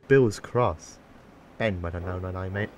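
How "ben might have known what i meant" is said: low-key huffy grumble, softer. A narrator's reading. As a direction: grumble with a touch of huff. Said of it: "he huffed".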